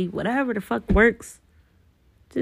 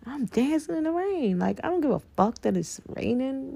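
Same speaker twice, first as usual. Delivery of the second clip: joyful; 7 semitones higher, 4 dB quieter than usual. Retold or imitated. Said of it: "Like how I say".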